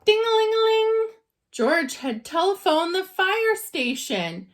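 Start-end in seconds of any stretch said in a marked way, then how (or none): 0.0-1.1 high, loud, quick ding-a-ling; ringing phone. A narrator's reading. The phone at the fire station rings, high and loud. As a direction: ring it out like a bright bell.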